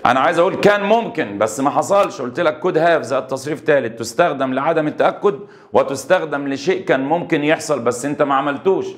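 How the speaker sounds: ordinary talk, nothing marked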